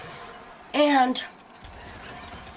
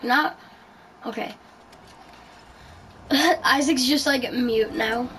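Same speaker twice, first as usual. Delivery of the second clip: ordinary delivery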